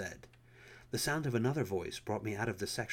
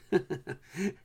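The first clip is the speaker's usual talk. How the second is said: real laugh; 8 semitones higher, 6 dB louder than usual. Real, not acted. Reader laughs at end of story.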